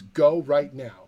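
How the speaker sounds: insist, one word at a time; firm command. Retold or imitated. Friend ordering him to the movie, slow and firm. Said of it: "He goes".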